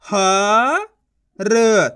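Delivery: ह drawn out with a rise, then र alone; letter sounding out. Shown as a demonstration. Teacher modelling one letter at a time, sounding out. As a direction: give ह and र each their own long sound. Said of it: "एक ही अक्षर का उच्चारण करके".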